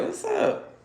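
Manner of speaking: high whispered mock greeting; breathy, high, sly hello; a high airy cool-guy greeting; Baron Samedi greeting. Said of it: "Baron sitting over there like".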